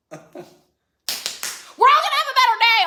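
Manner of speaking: high, loud, drawn-out rallying cry; over-the-top group pep-talk shout; mock pep talk. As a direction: belt it out as forced cheer, slowly.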